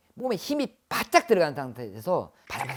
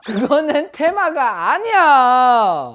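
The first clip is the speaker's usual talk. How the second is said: high, loud, drawn-out protest; emphatic loud denial; 9 semitones higher, 13 dB louder, much slower than usual. Real, not acted. Shaman dismissing fake exorcisms, emphatic shout. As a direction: stretch it out at full volume.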